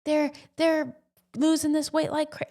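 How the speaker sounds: high mock-impressed envy; envious friend. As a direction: act stunned at their weight loss. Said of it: "And you're like".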